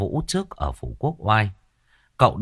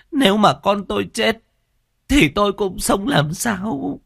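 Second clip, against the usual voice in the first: put-on pleading old mother; 11 semitones higher than usual. A narrator's reading. Old mother begs the master to save her daughter, high pleading. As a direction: beg for your daughter's life. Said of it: "mà van xin".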